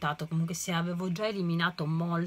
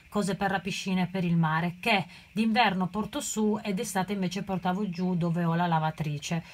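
normal talking voice

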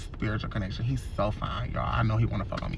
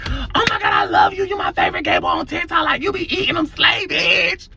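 gushing fan; 12 semitones higher than usual. Retold or imitated. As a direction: scream your love at the star. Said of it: "why she went up to my driver's side window and say".

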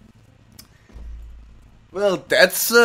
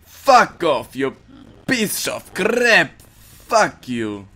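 exasperated anger; 2 semitones higher, much slower than usual. Pretend. Player cursing a game pursuer, exasperated anger.

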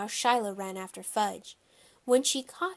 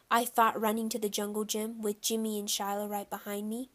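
ordinary delivery, nothing marked

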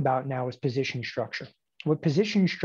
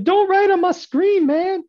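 high, loud, drawn-out complaint; mock protest; 8 dB louder, slower than usual. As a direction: imitate an outraged objector, pitched way up. Mimics someone protesting screen writing.

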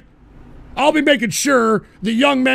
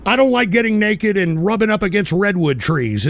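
ordinary delivery

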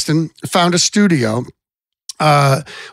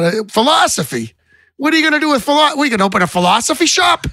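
higher, incredulous Boston dad breaking into a laugh; dad's Boston accent; 10 semitones higher than usual. Retold or imitated. Boston father mocking his son's philosophy major, higher Boston accent with a laugh. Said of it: "his Boston accent".

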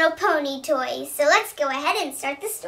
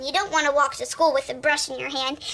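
usual speaking voice

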